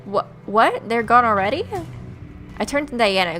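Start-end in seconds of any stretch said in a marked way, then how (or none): none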